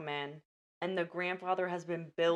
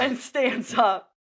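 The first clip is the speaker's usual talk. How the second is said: mock dramatic; 6 semitones higher, 9 dB louder, slower than usual. Acting out father banging table.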